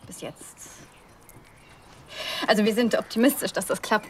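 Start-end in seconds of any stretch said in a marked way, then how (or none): 0.1-0.6 whisper. Acted. Unsicher beim Kinderwunsch.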